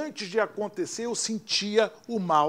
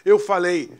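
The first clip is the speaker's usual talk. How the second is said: usual speaking voice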